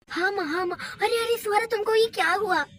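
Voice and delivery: a husband frantic over his fallen wife; panicked husband. Acted. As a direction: hurry her in fear.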